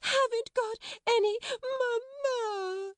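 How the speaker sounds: very high, slow, drawn-out wail; howling small child. A narrator's reading. Lottie wanting to be pitied, howling tempestuously. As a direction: howl each word like a child in a tantrum. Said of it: "Lottie howled tempestuously".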